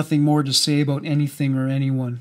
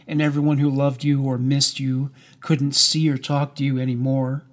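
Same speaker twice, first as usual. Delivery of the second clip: ordinary delivery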